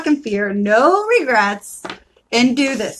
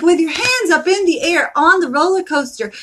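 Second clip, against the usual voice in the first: ordinary talk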